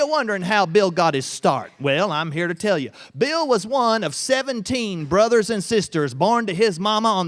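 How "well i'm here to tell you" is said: plain reading voice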